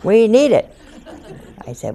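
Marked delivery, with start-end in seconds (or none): 0.0-0.7 louder, higher, laughing retort; mother scolding. Retold or imitated. Italian mother scolding her nun daughter, loud and laughing. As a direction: toss it back like an indignant Italian mother.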